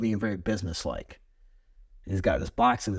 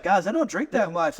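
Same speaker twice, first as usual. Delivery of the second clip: hammed-up alarm; mock panic; 8 semitones higher than usual. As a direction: blurt it out like caught off guard. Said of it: "I almost like".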